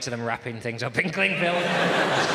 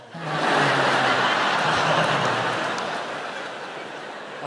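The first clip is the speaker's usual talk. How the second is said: big laugh; 6 dB louder than usual. Real, not acted. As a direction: laugh out loud at the joke. Laughing at licking her steak.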